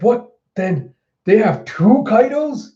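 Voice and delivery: freaked-out disbelief. Retold or imitated. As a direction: act out alarmed disbelief. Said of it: "samurai just freaking out".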